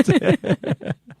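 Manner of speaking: high burst of laughter. Real, not acted. Laughing at Fetus.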